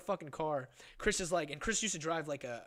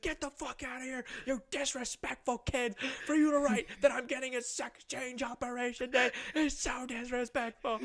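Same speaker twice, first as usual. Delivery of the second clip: imitated pissed-off rant; 10 semitones higher than usual. Pissed dad dressing down the kid, imitated fury. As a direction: scold like an enraged dad, hammed up. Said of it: "Just so fucking pissed".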